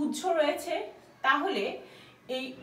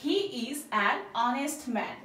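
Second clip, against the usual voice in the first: very slow, each word laid out; slow model sentence; much slower than usual. Shown as a demonstration. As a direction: model the sentence slowly for learners. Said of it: "তাহলে কিভাবে বলবো".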